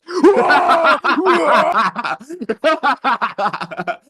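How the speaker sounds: high, loud cackling laugh. Real, not acted. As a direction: cackle loudly at your own joke. Zoom bomber laughs after taunting.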